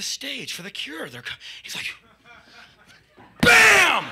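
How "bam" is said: loud high smash sound; mock smash shout; loud bam. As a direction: slam it out. Onomatopoeia of the smash.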